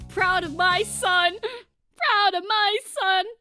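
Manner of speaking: loud, high, drawn-out wail; proud parent cheer. Pretend. Streamer hailing Klutzy as a son, mock-tearful pride. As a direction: slowly belt it out, overcome with pride.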